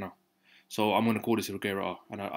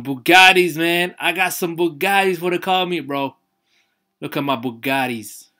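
put-on hyped American accent, pitched up and louder; 6 semitones higher, 9 dB louder, slower than usual. Bragging to viewers about his Bugattis in a put-on American accent. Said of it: "I'm saying it like an American".

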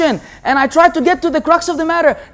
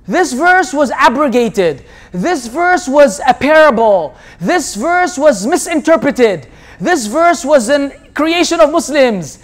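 mock excuses; slower than usual. Listing excuses mockingly.

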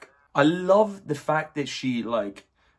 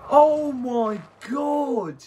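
stunned excitement; 9 semitones higher, 5 dB louder, much slower than usual. Real, not acted. Reacting to movie kill.

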